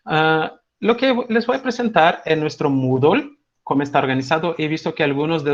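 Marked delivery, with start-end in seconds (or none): none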